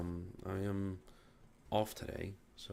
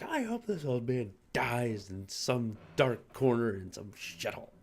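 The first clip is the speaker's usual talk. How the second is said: slow, louder, put-on fuming voice; mock angry rant; 4 semitones higher, 4 dB louder, slower than usual. Employee venting to manager about old man, mock angry yell. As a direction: replay the venting yell, half joking. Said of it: "I'm just yelling the most absurd things".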